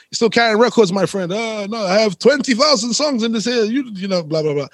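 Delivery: high, bright mock Spanish accent of a club DJ. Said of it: "so excuse the accent".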